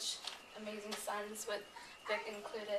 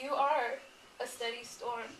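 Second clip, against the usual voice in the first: poem reading. Acted. Reading a poem to Ahmed's mother, tender and measured.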